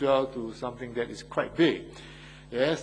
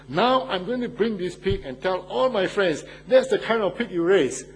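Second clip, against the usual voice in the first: gloating pastor; 6 semitones higher, 6 dB louder than usual. Retold or imitated. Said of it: "he said to him".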